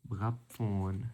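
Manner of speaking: normal talking voice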